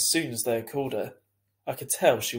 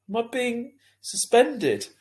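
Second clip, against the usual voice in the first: sniffling; 11 semitones higher, 4 dB louder, slower than usual. A narrator's reading. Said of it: "I sniffled".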